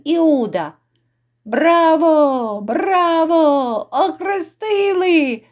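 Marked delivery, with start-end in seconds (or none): 1.4-5.4 high, drawn-out bravos; jeering cheer. Acted. Lords celebrating the forced baptism, jeering drawn-out cheer. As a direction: cheer long and high in mock triumph.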